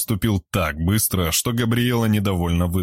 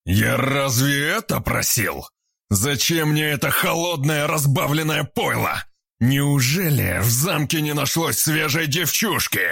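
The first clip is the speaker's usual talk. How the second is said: angry; 7 semitones higher than usual. Acted. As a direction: sneer it out with malice. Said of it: "злобно ответил".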